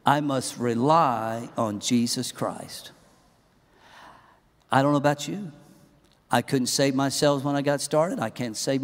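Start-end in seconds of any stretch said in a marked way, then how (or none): 0.0-2.9 slow, measured, lower-pitched line; leading a recitation. Shown as a demonstration. Preacher asking congregation to say it with him, measured. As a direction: lead them slowly so all can join. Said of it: "Say it with me".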